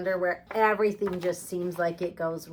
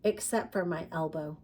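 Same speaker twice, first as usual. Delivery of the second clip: put-on reading a picture book aloud to children; lively read-aloud with emphatic refrains; story narration; 3 semitones higher, 6 dB louder than usual. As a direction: tell it like a storybook for kids.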